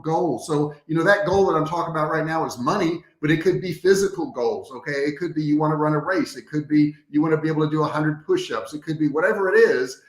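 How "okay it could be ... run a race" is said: usual speaking voice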